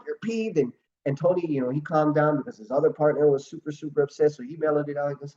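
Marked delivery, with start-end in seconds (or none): 0.1-0.6 high disbelieving question; much higher, surprised repeat; incredulous. Retold or imitated. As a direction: echo it back in disbelief. Partners baffled at the calm reply.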